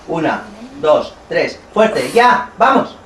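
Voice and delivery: rising shouted count; loud counting. Real, not acted. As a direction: count her into the push. Counting the push aloud.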